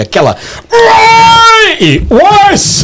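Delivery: high shouted honk of a horn; horn imitation. Imitating a Minas car horn.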